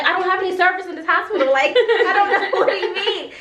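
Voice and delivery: high, loud, fast nervous laugh; nervous laughter. Real, not acted. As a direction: laugh out the confusion. Retells her nervous confusion, laughing.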